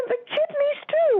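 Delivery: very high, slow, drawn-out cry of pain, imitated. Patient being knuckled on the foot, high pained wail. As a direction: wail the words as the foot hurts.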